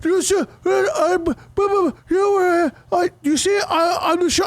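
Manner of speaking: very high, garbled mock protest; high-pitched mock blustering, words breaking up; imitating yelling driver. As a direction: sputter like the flustered shuttle driver. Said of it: "And then he starts yelling at me".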